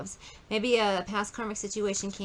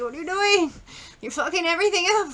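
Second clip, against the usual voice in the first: high, loud furious scolding from an angry elder; pissed great grandma; 10 semitones higher, 8 dB louder, faster than usual. Retold or imitated. An angry great grandma scolding her descendant, high loud and furious. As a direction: scold them much higher and louder, fed up. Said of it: "great grandma is really fucking pissed at them".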